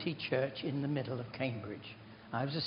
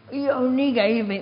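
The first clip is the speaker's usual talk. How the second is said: put-on higher, louder, an old man crying out; imitated loud complaint; 10 semitones higher, 9 dB louder than usual. Dying old man calling out from behind the curtains, loud complaint. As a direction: protest loudly from behind a curtain.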